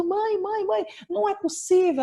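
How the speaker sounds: mother imitates her sobbing daughter calling her; imitating crying daughter. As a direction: cry out like a heartbroken child. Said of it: "chorando muito".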